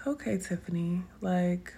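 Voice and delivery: soft whisper to herself; whispered self-talk. Retold or imitated. Whispering to herself to calm down. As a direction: whisper gently to yourself. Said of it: "but it's like".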